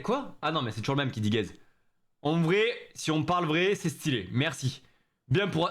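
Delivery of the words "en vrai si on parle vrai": normal talking voice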